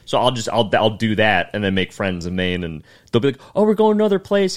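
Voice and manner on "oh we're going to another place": high, louder, upbeat group voice; imitated comics' invitation. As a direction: mimic friends announcing the next stop. Voices comics inviting him along.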